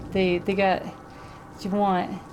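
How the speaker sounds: put-on slow thick Brooklyn accent. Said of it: "Brooklyn accent".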